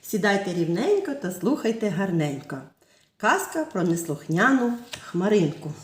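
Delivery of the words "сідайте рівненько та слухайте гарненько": ordinary talk, nothing marked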